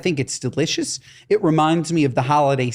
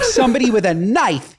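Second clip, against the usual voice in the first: ordinary talk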